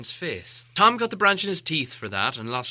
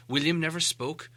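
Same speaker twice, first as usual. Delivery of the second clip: ordinary delivery, nothing marked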